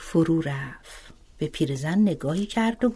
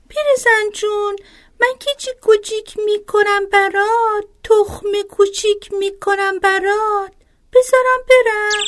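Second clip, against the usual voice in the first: much higher, chirpy little bird voice; tiny sparrow; 12 semitones higher, 4 dB louder than usual. Acted. Sparrow speaks in a fable.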